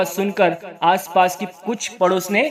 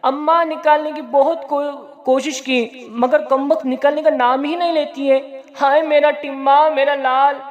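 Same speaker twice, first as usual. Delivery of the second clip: higher, mock-tearful wailing, a touch of sobbing; crying voice; 7 semitones higher than usual. Mother tearfully telling her mother the pot won't come off. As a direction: lament with a hammed-up weepy voice. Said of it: "नसीबुन रोने की आवाज़ में".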